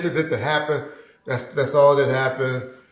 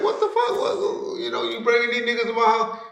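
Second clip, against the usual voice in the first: high, outraged mock woman's voice; shrill-pitched retold outburst at home; voicing her indignation way up high; imitating her; 10 semitones higher than usual. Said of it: "she was like".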